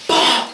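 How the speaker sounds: loud, high burst of "bah"; punch sound effect. Retold or imitated. Storyteller acting out the fight for a listener, loud punch noise. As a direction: imitate a punch landing hard.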